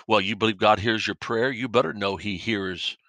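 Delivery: normal talking voice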